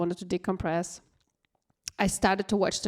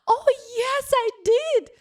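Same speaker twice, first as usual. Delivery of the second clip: high mock girlfriend voice; 6 dB louder than usual. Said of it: "And she's like".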